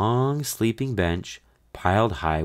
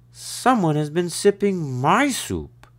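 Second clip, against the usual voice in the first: growled; 11 semitones higher than usual. A narrator's reading. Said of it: "she growled".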